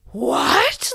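high gasp, then a rising "What?"; gasp, then ask "What?" in delight; excited gasp. Retold or imitated. Daughter hears about the tea party, gasps excitedly. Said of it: "Like, so excited".

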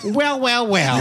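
mock sitcom sidekick in a high little voice; high weaselly voice. Small funny friend delivering his one joke, high and weaselly. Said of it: "still had that voice, it would be like".

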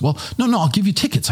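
high-pitched brushing aside of an objection; acted-out friendly insisting; retold insistence. Celebrity waves off refusal, insists.

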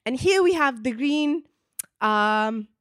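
imitating a waiter naming his dishes; Greek accent. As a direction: search for the word in broken English. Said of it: "he's like".

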